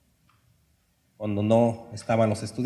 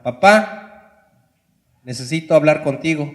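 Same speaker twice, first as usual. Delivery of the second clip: calling out; 3 semitones higher, 6 dB louder than usual. Retold or imitated. Son calls father behind newspaper.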